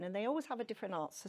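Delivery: usual speaking voice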